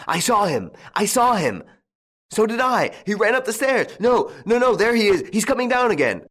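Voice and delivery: high, loud cries in a chase; excited shouting. A narrator's reading. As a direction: call out frantically in pursuit. Several sons shout during a chase.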